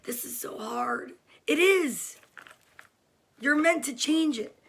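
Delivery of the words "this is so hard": whiny whisper. Retold or imitated.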